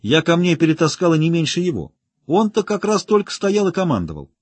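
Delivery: high-pitched indignant complaint. A narrator's reading. Carolina complains that she did as much work, indignant. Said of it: "возмутилась тут же Каролина".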